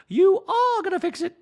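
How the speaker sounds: very high, loud socialite impression; Dorit voice. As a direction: squeal it out like a pampered socialite.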